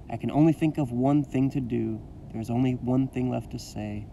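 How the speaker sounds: usual speaking voice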